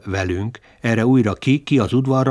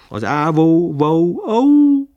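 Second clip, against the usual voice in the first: ugatásként, vinnyogásba csúszva; barking; 7 semitones higher, 6 dB louder, much slower than usual. Acted. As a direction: bark the word, rising to a yelp. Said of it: "úgy hangzott akár az ugatás".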